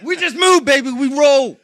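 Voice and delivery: loud, high hype host voice; hype announcer. Pretend. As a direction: pump it up like a showman. Hyped show host bit.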